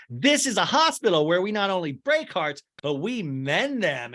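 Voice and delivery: playfully imitated seductive nurse; mock sexy nurse. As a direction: put on a sultry nurse act. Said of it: "like, very sexy".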